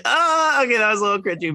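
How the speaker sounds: high, awkward oh okay; cringing reaction. Retold or imitated. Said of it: "I was like".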